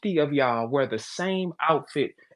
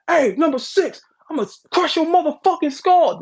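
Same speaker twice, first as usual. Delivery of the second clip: higher and louder, a hammed-up trash-talk yell; mock football scream; 11 semitones higher, 5 dB louder than usual. Football player screaming threats at an opponent, mock yell. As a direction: bark it across the field at number six. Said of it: "when they scream out".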